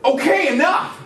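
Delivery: high, loud mock blow-up; acted fed-up yell; exasperated outburst. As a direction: burst out as if at breaking point. Snaps at coworkers.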